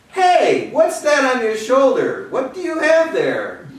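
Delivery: high, loud hail to a stranger; calling out. Acted. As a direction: call out across the road.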